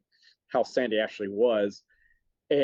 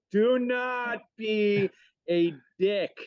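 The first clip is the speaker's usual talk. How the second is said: slow, drawn-out, higher and emphatic; louder and slower; 9 semitones higher, much slower than usual. Shown as a demonstration. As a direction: spell it out slowly for the people in the back. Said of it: "I'll say it louder and slower".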